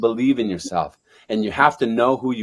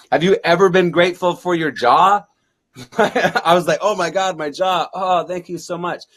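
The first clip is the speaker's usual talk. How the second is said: 1 overjoyed gratitude; 7 semitones higher, 6 dB louder than usual. Retold or imitated. Overjoyed about jaw recovery.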